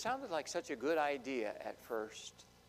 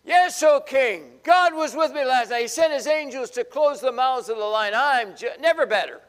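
much higher, louder triumphant reply; Daniel's cheerful shout; 9 semitones higher, 15 dB louder than usual. Acted. Daniel answering the king joyfully, loud triumphant shout. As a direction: boom back cheerfully from the den. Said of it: "Daniel answers the king".

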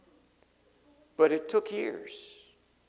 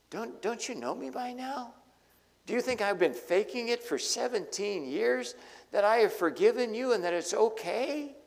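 much higher, pained, pleading voice; wounded that they doubt him; hurt disbelief; 8 semitones higher than usual. Acted. Joseph hurt by brothers' distrust.

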